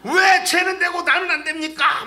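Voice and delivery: loud, high-pitched mock grievance; acted outraged complaint; argumentative protest. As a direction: demand an answer from God, hammed-up. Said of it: "막 이러고 따지는 게 아니라".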